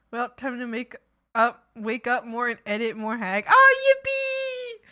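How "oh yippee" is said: mock cheer. Sarcastic cheer at editing.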